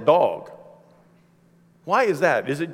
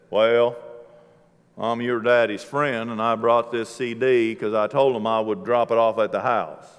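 friend's voice pitched lower; lower, easygoing impression of Joe; imitating Joe; 8 semitones lower than usual. Friend Joe at the door, lowered voice. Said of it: "That's exactly what he sounds like".

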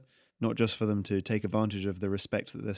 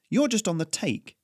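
higher, cutting accusation; snapping accusation; 9 semitones higher, 3 dB louder than usual. A narrator's reading. Melora accuses the greedy tax collector, snapping. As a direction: throw the charge back at him. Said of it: "Melora snapped".

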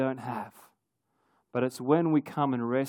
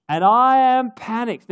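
panicked; 8 semitones higher, 8 dB louder, slower than usual. Retold or imitated. Retelling panic underwater while scuba diving.

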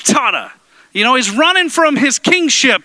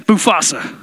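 mock deep voice; 8 semitones lower than usual. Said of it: "Look to your other neighbor and say".

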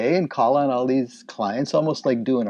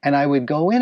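ordinary delivery

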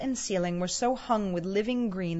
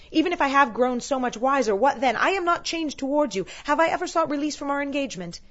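higher, insistent self-justification; indignant lover's rebuttal, pitched up; defensive protest; 6 semitones higher, 5 dB louder than usual. A narrator's reading. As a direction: protest hotly to a betrothed. Acted quarrel, young Scrooge defends himself.

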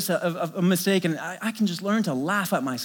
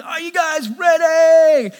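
big hype shout to the crowd, much higher and louder; yelling out; 10 semitones higher, 11 dB louder, much slower than usual. Retold or imitated. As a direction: holler it slow to pump up the kids. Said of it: "I yell out".

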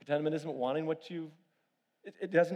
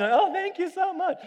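mock sweet thanks; 5 dB louder than usual. Imitating wife's cheerful thanks.

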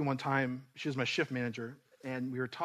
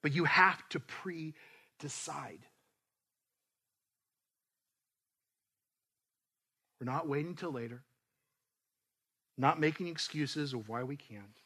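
slow, emphatic exhortation; preaching; slower than usual. Real, not acted. Pastor urging the church to give, slow and firm. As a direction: stress each step like a charge.

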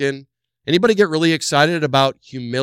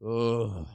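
disgusted groan; 4 semitones lower, 9 dB quieter than usual. Retold or imitated. Disgust at her arrival.

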